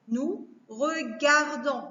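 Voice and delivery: "nous regardons" slowly, as nous... re-gar-dons; measured beats through "nous regardons"; split into syllables. Shown as a demonstration. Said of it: "Il y a trois syllabes".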